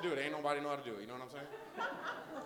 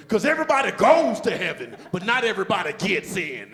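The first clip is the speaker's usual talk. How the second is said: pastor screaming; 6 dB louder than usual. Retold or imitated. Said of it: "the pastor screamed".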